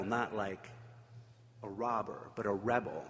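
normal talking voice

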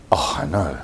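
breathy whisper to the room; whispered aside. Real, not acted. Lecturer whispering to groaning audience, knowing whisper.

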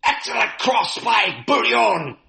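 high, slow, excited match commentator; soccer announcer voice. Shown as a demonstration. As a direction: call it like a live soccer play-by-play. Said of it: "that was my soccer announcer voice".

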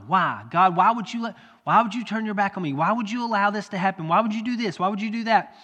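loud, rising pleading questions; anguished why. Acted. Believer in trouble pleading with God, loud lament. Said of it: "we ask why".

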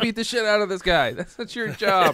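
hammed-up outrage at the prosecutor; mock outraged yelling.

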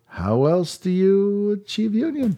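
put-on slow, high, sing-song Yiddish accent; playful Yiddish accent on a rhetorical question. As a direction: lean into a hammed-up Yiddish lilt. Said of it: "In your best Yiddish accent".

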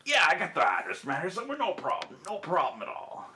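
high Deveronian bartender, sliding down; easygoing put-on Deveronian accent. Bartender has the address for customer, high Deveronian accent. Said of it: "all Deveronians are gonna talk like this".

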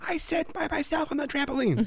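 high, pouty little kid imitation; whiny kid voice. Dad voicing the child on the trampoline, high whiny kid imitation. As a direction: play the offended toddler.

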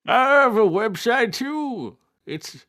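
put-on high old grandpa character voice; chirpy old man showing off. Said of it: "Grandpa Dan".

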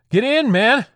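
high, loud yell; frantic, full-voiced order; urgent shout. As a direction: shout him through the door now. Hurrying companion into the bunker.

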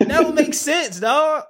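very high, slow squeal of disbelief; incredulous. Real, not acted. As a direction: drag it out high, astonished. Still reeling at flight length.

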